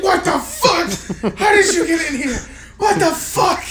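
high, loud, laughing disbelief; hammed-up shock at a stranger in the yard; startled. Buddy finding Lori at his gate, high startled laughing yell. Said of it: "He's very startled".

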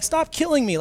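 put-on high squealing child's cry; mock toddler wail. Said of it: "she sounds like she's saying".

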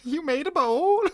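very high, mock-disappointed character voice; Cotton. Co-host quoting a favorite show moment, mock disappointed. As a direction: quote him in a squeaky, hammed-up letdown. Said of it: "He's so disappointed".

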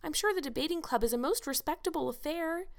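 put-on high, earnest girlish plea; pleading. A narrator's reading. Young Anne pleading with Marilla about the concert. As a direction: coax the stern guardian. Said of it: "pleaded Anne".

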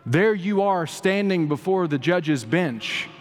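high-pitched, emphatic sermon delivery; impassioned preaching. Real, not acted. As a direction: proclaim it phrase by weighty phrase to a congregation. Preaches scripture and courtroom parable.

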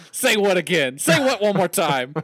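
high, loud, playful threat; hammed-up movie-style outburst; mock menacing shout. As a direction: dare them loudly, hamming up the rage. Acting out a threatening film quote.

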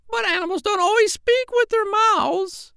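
very high parrot voice. Acted. Parrot correcting the doctor about dogs, very high voice. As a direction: lift it way up like a bird. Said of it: "said the parrot in a high voice".

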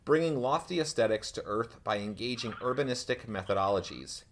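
ordinary talk

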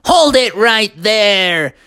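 slow, high commanding cry; SpongeBob yelling. Acted. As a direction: call a halt, stretching each word. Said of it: "SpongeBob yelled out".

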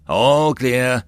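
high, loud gunner's call; warning shout. A narrator's reading. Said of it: "the gunner warned".

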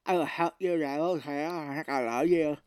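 slurred, mangled mock Novocaine speech; hammed-up drooling through a numb mouth. Numb actor trying to look hot, slurred drooling speech. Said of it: "And he's drooling".

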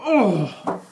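hammed-up "oh" of pain; acted hit reaction. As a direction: cry out as if just struck. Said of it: "he's gonna go".

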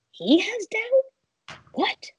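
high, slow disbelief in an odd voice; incredulous inner voice. Retold or imitated. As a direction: stretch it out in disbelief. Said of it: "And I'm thinking".

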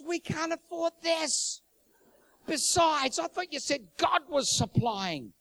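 high, slow mock-wifely retort; challenging wife. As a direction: throw it back at him in a put-on high voice. Wife throws his faith back.

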